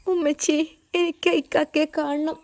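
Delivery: put-on a small girl begging to go along; crying child. Little girl begging her mother to follow Safeer, mild tearful voice. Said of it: "Ayisha അവളെ സമാധാനിപ്പിച്ചു".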